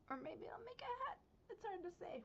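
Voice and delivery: very high, soft sing-song; coy little high-pitched aside; coy teasing. Real, not acted. Teasing she may keep it.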